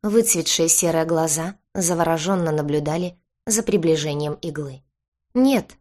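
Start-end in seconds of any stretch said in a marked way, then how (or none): 5.3-5.8 high, loud yelp of refusal; squealed cry. A narrator's reading. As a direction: squeal it out in fright. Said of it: "взвизгнул Киши".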